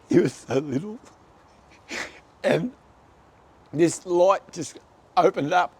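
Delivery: deeply moved, voice straining high; choked up. Real, not acted. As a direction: testify through welling tears. Moved, recounting his inner healing.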